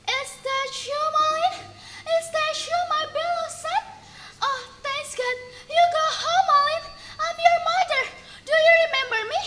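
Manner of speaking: high, soft voice of a tearful joyful mother; old mother greeting her son Malin, high and hushed; overjoyed old mother. Acted. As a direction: reach out to a long-lost son. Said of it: "She was shocked and happy".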